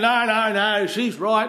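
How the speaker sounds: dismissive brush-off. Retold or imitated. Stressed driver waving off his passenger's warning, dismissive.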